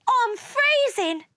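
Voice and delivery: high-pitched wail; freezing complaint. A narrator's reading. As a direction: wail about the cold. Mars complains of cold.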